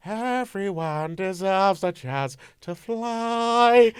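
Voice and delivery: very slow, drawn-out Goldblum line; Jeff Goldblum impression. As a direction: savour each word like Goldblum. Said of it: "when he's like".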